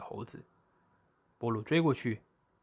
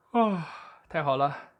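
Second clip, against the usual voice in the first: slow, high, louder yawning sigh; 5 semitones higher, 5 dB louder, slower than usual. A narrator's reading. Lazy bear yawning before a nap in the shade. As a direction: stretch it out through a yawn.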